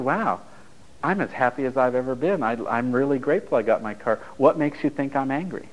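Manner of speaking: normal talking voice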